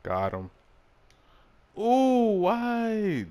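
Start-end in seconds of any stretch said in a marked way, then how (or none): 1.8-3.3 shocked disbelief. Real, not acted. Player reacts to a bad call.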